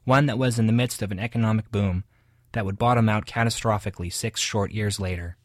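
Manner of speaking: loud, polished radio announcer reading a review; booming broadcast review voice. Acted. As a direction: present it like a radio host, crisp and loud. Radio review read, music at end.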